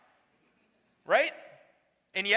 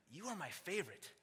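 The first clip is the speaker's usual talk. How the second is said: much softer, hushed repeat of "You are my favorite."; soft echo; 13 dB quieter than usual. Shown as a demonstration. Preacher echoing grace's words to the church, suddenly hushed. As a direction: drop to a near hush on "You are my favorite.".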